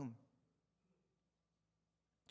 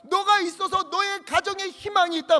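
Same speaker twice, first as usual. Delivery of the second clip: builds higher and louder in delight; God's joyful affirmation; 9 semitones higher, 10 dB louder than usual. Acted. God delighting in his faithful people, rising joyful proclamation. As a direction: proclaim God's joy over them. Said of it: "이 말이".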